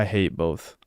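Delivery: ordinary talk, nothing marked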